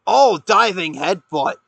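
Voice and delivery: loud, slow, high call of the move; excited call. Real, not acted. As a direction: shout out the headbutt. Big move called out.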